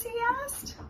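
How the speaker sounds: usual speaking voice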